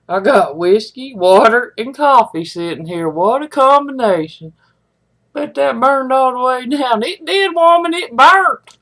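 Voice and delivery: normal talking voice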